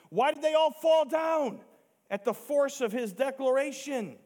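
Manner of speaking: high, loud, insistent rhetorical questions; full-force preaching. Real, not acted. Preacher challenging those who deny Jesus is God, full-force preaching. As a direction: drive each question home to the congregation.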